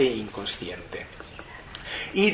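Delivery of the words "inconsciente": a soft, slow whisper on the last word; hushed, slowed-down whisper. Acted. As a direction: let the word fall away into a whisper. Lecturer drops to a hush.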